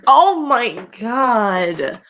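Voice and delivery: loud, drawn-out horrified cry. Real, not acted. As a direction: stretch it out in disgust. Horrified by fanfic line.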